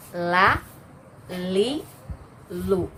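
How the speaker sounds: la li lu with tongue tip on the gums; makhraj demo. Shown as a demonstration. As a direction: clear la li lu. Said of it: "ujung lidah dengan gusi-gusi atas".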